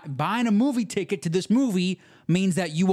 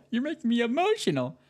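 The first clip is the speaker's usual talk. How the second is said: mock emotional; 8 semitones higher than usual. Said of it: "you're making me cry".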